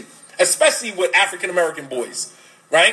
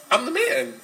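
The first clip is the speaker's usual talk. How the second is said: proud boast; 7 semitones higher than usual. Retold or imitated. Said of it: "Does that not make you feel like".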